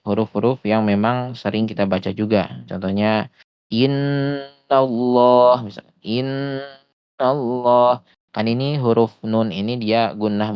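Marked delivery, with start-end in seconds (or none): 2.7-8.1 "innallah" slow, doubled n held; pressed tasydid. Shown as a demonstration. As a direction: lean hard on the nn in "innallah". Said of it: "harus ditekan karena dia tasydid".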